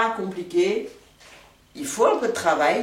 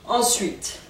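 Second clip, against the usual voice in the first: ordinary talk, nothing marked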